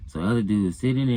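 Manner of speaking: usual speaking voice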